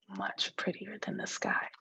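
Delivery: hushed whispered agreement. Acted. Friend whispering praise to the girl. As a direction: breathe it out like a secret.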